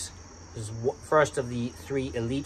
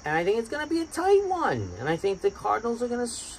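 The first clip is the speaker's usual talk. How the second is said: high goofy voice; 8 semitones higher than usual. Acted. High put-on voice for a pick.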